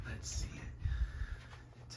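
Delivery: hushed whisper so the snake can eat. Real, not acted. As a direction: barely breathe it out. Quietly watching snake eat.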